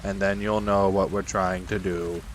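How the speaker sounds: low, gloomy donkey voice; Eeyore instructing. Acted. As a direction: drone it low and evenly. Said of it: "said Eeyore".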